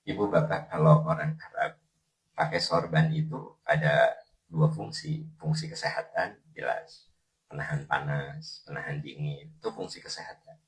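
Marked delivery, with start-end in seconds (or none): none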